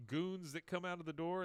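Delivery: usual speaking voice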